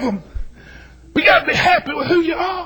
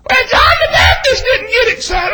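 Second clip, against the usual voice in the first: high-pitched, loud mimicry of a critic; mock objector; 8 semitones higher, 5 dB louder than usual. Acts out an objector in congregation.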